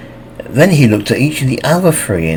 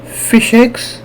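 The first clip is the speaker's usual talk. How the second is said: puzzled echo; 13 semitones higher than usual. Acted. Harpy puzzled by wrong word.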